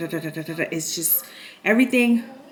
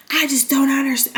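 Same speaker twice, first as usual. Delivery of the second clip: mock stepdad; 6 semitones higher, 4 dB louder than usual. Stepdad voiced again.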